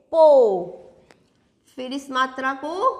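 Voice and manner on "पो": पो with the "oh" held; teacher's model पो with a stretched "oh"; long O sound. Shown as a demonstration. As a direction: draw out पो as "pohh". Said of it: "इसको हम बोलेंगे".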